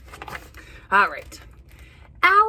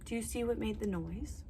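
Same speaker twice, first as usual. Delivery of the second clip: hushed question; 2 semitones lower, 15 dB quieter, faster than usual. Acted. Asking the children quietly.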